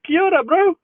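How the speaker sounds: very high, playful kia ora; big mock greeting. Streamer trying out a local hello, big high mock greeting. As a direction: pitch the greeting way up, hammed-up. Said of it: "wouldn't that be like a big".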